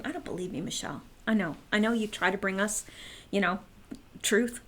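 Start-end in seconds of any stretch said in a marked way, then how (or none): 0.0-1.0 a soft, hushed mock viewer voice; half-whispered imitation of a skeptic; quoting a doubting viewer. Imitating a viewer who doubts her, softly. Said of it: "I know you're saying".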